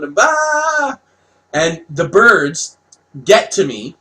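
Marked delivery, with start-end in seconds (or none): 0.1-1.0 high, drawn-out "bah" yell; mock scream. Fleeing the birds in the story, mock scream. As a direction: imitate a panicked scream on a high held note. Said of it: "I'm screaming, and I'm yelling".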